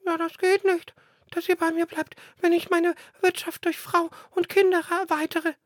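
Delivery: high, loud chirpy bird voice; smallest sparrow. Acted. Youngest sparrow refuses his brothers, high chirping voice. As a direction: squeak it like a tiny sparrow. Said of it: "meinte der Kleinste".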